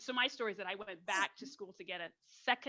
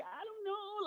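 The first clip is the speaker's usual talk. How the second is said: high, softer helpless protest; mock fearful; 10 semitones higher, 6 dB quieter, slower than usual. Recalling herself as a young student, mock fearful. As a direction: act out being lost and afraid. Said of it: "I was so afraid".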